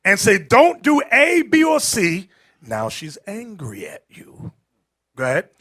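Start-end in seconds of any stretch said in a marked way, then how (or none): 0.4-2.3 louder, slow firm order, half-acted; raised voice. A man raising his voice at a sister, firm slow order. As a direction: lay down the law slowly, voice raised. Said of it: "the second you raise your voice at her".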